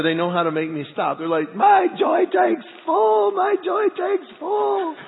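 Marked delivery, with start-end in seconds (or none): 1.5-5.0 put-on high, slow, loud child's squeal; giggly kids begging. Boys begging dad to stop tickling, high squealing child voice. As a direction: squeal it like a tickled kid pleading.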